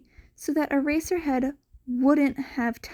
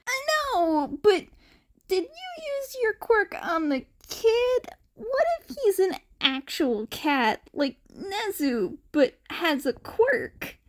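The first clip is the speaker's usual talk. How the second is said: a touch higher, eager and curious; lightly voiced eager husband; 9 semitones higher than usual. A narrator's reading. Mike urges Aizawa to test the kid, eager. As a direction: pitch the wild idea brightly.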